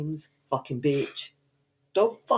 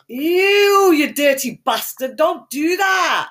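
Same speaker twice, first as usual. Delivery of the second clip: high, loud, drawn-out revolted yell; disgusted shout; 8 semitones higher, 7 dB louder, much slower than usual. Real, not acted. Presenter scolding co-host's gross act, disgusted shout. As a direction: recoil and shout it, stretching the words.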